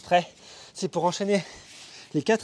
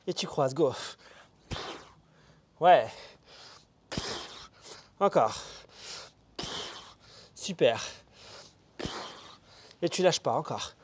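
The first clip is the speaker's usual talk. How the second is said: speaking mid push up; coaching while exercising; doing push ups; 3 semitones lower, 5 dB quieter than usual. Real, not acted. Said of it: "Les pompes".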